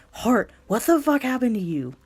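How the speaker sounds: furious worry for her friend; startled swearing; shocked anger. Acted. Friend finds her sobbing.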